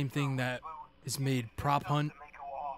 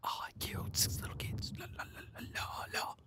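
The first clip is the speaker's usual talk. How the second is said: unvoiced eerie whisper; whispered creepy villain; 9 dB quieter than usual. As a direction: whisper it like a killer.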